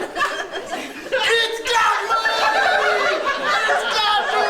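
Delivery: high, long-drawn mock scream; hammed-up panicked screaming, stretched out; Paul. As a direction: shriek as if the snake has grabbed you. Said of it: "He starts screaming".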